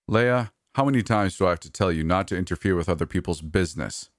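plain reading voice

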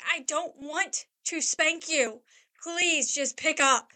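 put-on pleading with kids at the end of your rope; exasperated plea. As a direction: beg slowly, every word leaned on.

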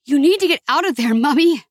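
lightly acted panic, words rushed; urgent alarm. A narrator's reading. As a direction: urge her to get out now. Urging mother to flee fire.

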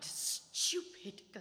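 slow, unvoiced scolding to herself; muttered self-reproach. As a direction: hiss it at yourself in disgust.

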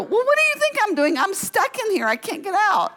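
very high, worked-up mock protest; acted exasperation. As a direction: re-enact an exasperated trapped woman answering back. Exasperated answer from locked bathroom.

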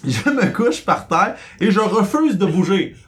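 dog refusing. Pretend. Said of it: "Elle a dit".

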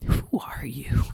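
hushed, voiceless question; baffled whisper. Acted. Baffled aside to the lamenting mascot.

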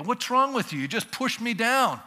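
acted aggrieved complaint; indignant protest. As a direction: protest like someone just shoved over. Pushed person protests after falling.

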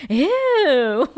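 high, loud, grossed-out "Ew."; disgusted ew. Retold or imitated. Buffy recoiling from the magician's gross skin, a loud disgusted ew. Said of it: "She's like".

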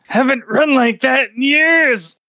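just ran outside to be sick, now catching breath; wheezy exclamation after a sprint; winded old friar. Acted. Friar back from retching.

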